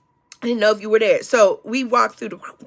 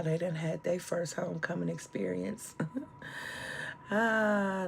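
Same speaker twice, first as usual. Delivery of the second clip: aside off mic; 11 dB quieter than usual. Real, not acted.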